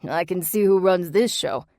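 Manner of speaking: loud Jersey schmooze; brash Jersey flattery; Jersey accent. A narrator's reading. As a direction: sell it like a Jersey salesman. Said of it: "in his thick Jersey accent".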